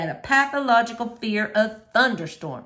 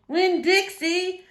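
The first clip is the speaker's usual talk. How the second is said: high, slow called-out name; preacher shouting for the dog; 9 semitones higher, slower than usual. A narrator's reading. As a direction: call out long across the town. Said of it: "the preacher shouted".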